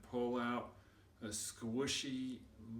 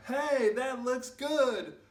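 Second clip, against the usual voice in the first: loud, high, hammed-up hungry hyena; 13 semitones higher, 8 dB louder than usual. Hyenas boasting over a dead thing, loud and high. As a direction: boast like a ravenous cartoon beast.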